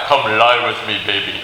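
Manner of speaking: low, coaxing come-on; seductive temptress. Acted. Potiphar's wife propositioning Joseph, low and sultry. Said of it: "Potiphar's wife".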